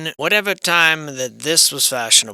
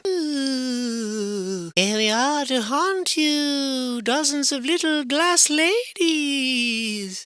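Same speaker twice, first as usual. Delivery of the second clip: very high, slow, wavering ghost voice; haunt the room as a chorus of tiny glass ghosts; spooky sing-song spirit; ghostly voice; 11 semitones higher, much slower than usual. Pretend. Voicing the haunting glass figures.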